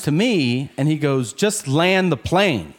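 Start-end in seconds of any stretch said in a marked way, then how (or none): 1.3-2.7 slow, slightly raised demand; restrained insistence. Retold or imitated. Passenger turns to seatmate about the delayed landing, restrained insistence. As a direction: insist slowly without shouting. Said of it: "more aggressive".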